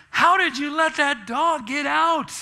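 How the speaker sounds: loud, high accusing question; scolding tone. Retold or imitated. Husband scolding wife for letting the dog out, loud and high. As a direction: blame her, exasperated. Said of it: "unhappy 'cause of the tone of my voice".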